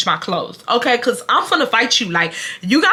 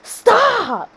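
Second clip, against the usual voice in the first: high, drawn-out yell; retold angry yell; 12 semitones higher than usual. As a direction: imitate a husband yelling really loud. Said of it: "he yelled really loud".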